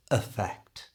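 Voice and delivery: "effect" starting on a reduced uh; schwa at the start. Shown as a demonstration. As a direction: soften the first E of "effect" into a schwa. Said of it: "changed to the schwa sound".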